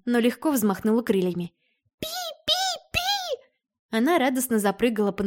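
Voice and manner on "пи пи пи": very high, slow, soft chirping; high bird chirp. Acted.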